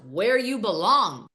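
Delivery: ordinary talk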